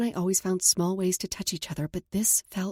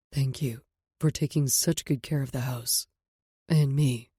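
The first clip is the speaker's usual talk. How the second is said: lower, tender male voice; man's voice; 5 semitones lower than usual. Acted. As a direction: speak as a calm man. Knox toast.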